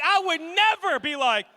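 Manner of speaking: loud, high mock outrage; indignant outburst. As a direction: shout it in comic disbelief. Acted indignation continues.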